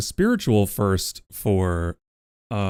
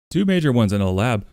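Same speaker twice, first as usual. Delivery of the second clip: ordinary delivery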